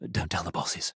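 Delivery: whispered secret aside; conspiratorial whisper. Acted. Mascot whispering to the player to keep quiet. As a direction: hush it so the bosses can't hear.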